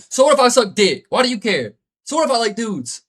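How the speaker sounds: high, loud mock-defiant comeback; defiant retort. Retelling how he talked back to bullies, loud and defiant. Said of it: "I was just like".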